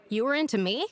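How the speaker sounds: surprised. Acted. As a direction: ask it in disbelief, rising sharply at the end. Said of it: "Don't sound so surprised".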